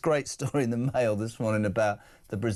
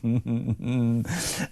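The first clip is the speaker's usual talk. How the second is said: amused chuckle; laugh; 3 semitones lower than usual. Real, not acted. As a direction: chuckle at a silly joke. Laughing at listener's nickname joke.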